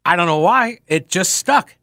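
ordinary talk, nothing marked

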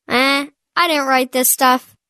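normal talking voice

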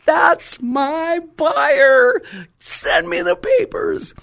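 high, loud, stretched-out cry; acted jubilant holler; triumphant outburst. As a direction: slowly belt it out in victory. Retold triumph at finding the buyer.

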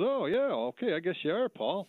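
high, stunned, half-believing reply; dazed surprise. Retold or imitated. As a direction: caught off guard by a movie star. Meeting Paul Newman at door.

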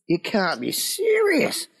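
high, slow disbelief; retold astonishment. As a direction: stretch it out, acting dumbfounded. Retold stunned reaction to the reunion.

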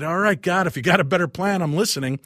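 loud, high plea to the sky, half exasperated; calling out to God. Retold or imitated. Man at a window calling up to God, loud and exasperated. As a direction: shout it up to heaven like you've had enough.

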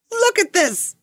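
high-pitched mock exclamation; excited calling out. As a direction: call it out high, like a sudden find. Acted excited repairman calls down.

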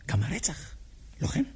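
hushed, secret whisper. Acted. Confessing his doubt to the killer, in a whisper.

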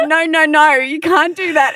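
high, loud protesting no; hammed-up alarmed protest; mock panic. Mimicking a coworker's panicked denial, high and loud. Mocking Laura's reaction.

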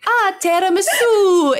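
high, slow, cheery tiramisu; sing-song code word. Pretend. Mother saying the code word tiramisu, high and slow. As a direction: croon the dessert name. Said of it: "if I say, like".

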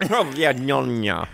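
PROVOLONE drawn out slowly as "prov-ya-NYON-ya"; slow, hammed-up provolone with extra J sounds; mock-foreign provolone. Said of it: "adding a J in there".

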